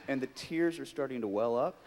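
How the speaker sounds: usual speaking voice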